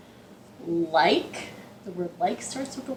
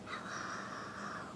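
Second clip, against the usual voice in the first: faint whispered hello; 20 dB quieter than usual. Shown as a demonstration. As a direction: whisper it as quietly as you can. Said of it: "as quiet as we can".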